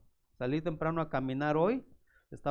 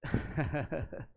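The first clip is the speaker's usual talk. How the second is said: short laugh. Real, not acted. Laughs at the old taunt.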